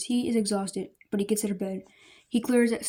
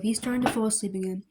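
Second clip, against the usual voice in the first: ordinary delivery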